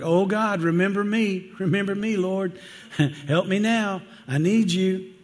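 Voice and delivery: high-pitched pleading prayer; mock desperate plea. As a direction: act out begging God for help. Acts out desperate prayer in trouble.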